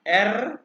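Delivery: एर with the tongue vibrating on the R; rolled R. Shown as a demonstration. Teacher showing tongue vibration, एर with rolled R. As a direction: say एर loud and high, trilling the R. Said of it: "जुबान को vibrate करना है".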